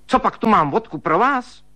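sharp, high reprimand; stern. Acted. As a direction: tell him off like a strict old maid. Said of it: "spustila přísně švagrová".

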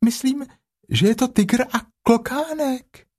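very high, small piglet voice; high-pitched little animal. Acted. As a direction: squeak it out like a tiny piglet. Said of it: "zvolalo prasátko".

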